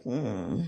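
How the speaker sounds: low, soft disapproving "hmm"; unimpressed hum. Retold or imitated. She reacts to the coworker's excuse, low unimpressed hum. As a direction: swallow the irritation in a low hum. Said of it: "And I'd be like".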